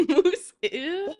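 high, laughing ew; laughing disgust. Real, not acted. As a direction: giggle out the disgust. Laughs at moose ankles.

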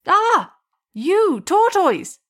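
high, slow shouted accusation; crowd shouting. Acted. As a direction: call it out as an angry crowd. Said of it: "the crowd shouted".

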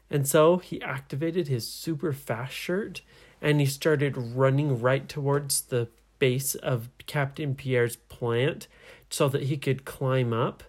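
plain reading voice

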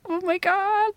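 high squeal of joy; gleeful high-pitched oh my God; delighted squeal. Acted. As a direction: shoot way up in pitch, thrilled. Thrilled the ex saw them.

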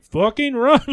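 retold yell. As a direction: fire it off like a mock order.

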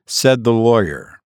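ordinary delivery

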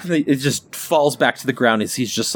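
usual speaking voice

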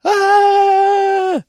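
high, loud sustained scream; hammed-up movie scream, held long; Kevin McAllister. Showing what happens in Home Alone, imitated scream. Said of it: "Kevin McAllister is like".

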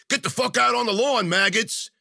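high, loud drill-style bark; barked order. A narrator's reading. Military goons herding prisoners outside, barking. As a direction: bellow it at the whole barracks. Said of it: "barking at everybody".

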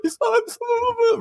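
high, crybaby whimper; mock blubbering. Crying boy hugged by his crush, mock blubbering. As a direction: imitate crying too hard to get words out. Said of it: "in the hiccup stage".

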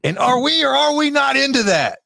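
booming wrestling announcer; loud, high hype call; wrestler voice. Pretend. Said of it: "I was like a wrestler for a minute".

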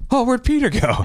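high-pitched imitation of puzzled campers; mock bewilderment. Campers around the fire notice a friend has vanished, mock bewilderment.

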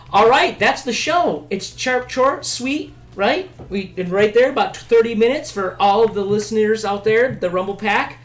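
high, loud podcast host wrap-up; show sign-off. Acted.

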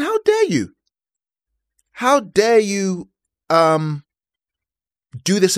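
squeaky mock indignation settling into slow emphasis; retold anger. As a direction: act out affronted outrage, drawing out the repeat. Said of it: "I was angry".

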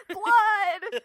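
loud high laughing yell of blood; hammed-up scream of blood; mock shout. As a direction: blurt it out, cracking up. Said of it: "freaking out".

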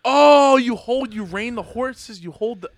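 sudden loud realization; excited outburst. Real, not acted. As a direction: burst out with the idea. Exclaimed realization, big jump.

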